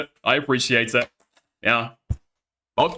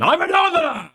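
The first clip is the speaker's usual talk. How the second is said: I'm a northerner yelled much higher, indignant; indignant cry; 12 semitones higher than usual. Real, not acted. Insisting to chat he's a northerner, high indignant shout. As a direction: blurt it out in an indignant high yell. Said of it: "I am northern".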